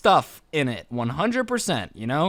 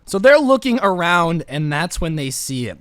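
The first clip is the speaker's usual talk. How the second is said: ordinary talk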